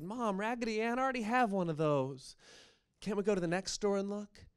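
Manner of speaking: put-on high, whining teenage girl; whiny teen. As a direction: plead like a bored teen. Teen daughter whining in story.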